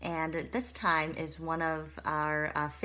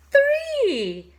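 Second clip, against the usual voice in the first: shocked cry; 13 dB louder than usual. Acted. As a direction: jump high and loud on the last count. Counting eggs, shocked.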